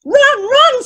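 high-pitched storyteller's crowd cry; ringing, excited yell; screaming crowd. A narrator's reading. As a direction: cheer it out like a massive crowd. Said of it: "screamed the massive crowd".

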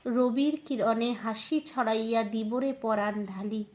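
slow, loud, impassioned Bengali poetry recital; declaimed verse in measured, ringing phrases; poem recitation. Acted. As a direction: recite it with swelling fervour, drawing the words out. Recites a poem, then chats.

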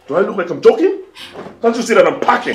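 loud, high rhetorical questions; angry retort. Acted. Husband packing to leave his wife, angry retort. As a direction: throw the questions back loudly.

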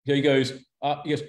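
ordinary talk, nothing marked

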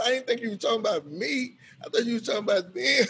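protesting. Retold or imitated. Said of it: "I said".

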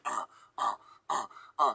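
low, soft rhythmic "uh"; imitated bopping grunts. Retelling bumping to music in the backseat, grunting. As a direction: grunt softly along to an imagined beat.

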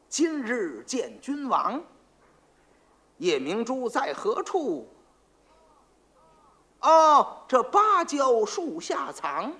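slow, drawn-out mock-mystic verse; pompous recitation. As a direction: intone it like a fake sage. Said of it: "他真能装模作样的". (老黄在皇上面前慢吟卦诗)

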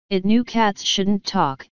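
usual speaking voice

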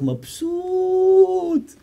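drawn-out, very slow satisfied sigh of a word; content. Acted. A man happily eating bread with hummus, slow and content. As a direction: stretch it out, blissfully pleased.

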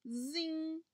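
drawn-out buzzing zing; imitated phone ring. A narrator's reading. Narrator imitating the telephone as it rings, drawn-out buzz. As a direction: stretch it out like a ringing phone. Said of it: "the phone went".

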